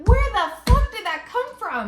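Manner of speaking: high, loud shocked cry; where did that come from, high and loud; shocked outburst. Retold or imitated. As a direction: blurt it out, stunned. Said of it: "and I'm like".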